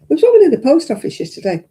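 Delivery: lively new story. Real, not acted. Starting a new anecdote.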